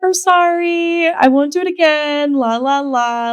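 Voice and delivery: slow, high, sing-song sorry that trails off into la la la; fake-contrite then breezy; mock apology. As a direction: play the sheepish apology you don't really mean. Mock apology returning to club.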